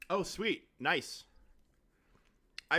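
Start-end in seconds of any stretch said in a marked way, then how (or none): none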